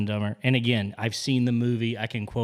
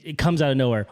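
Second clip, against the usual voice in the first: normal talking voice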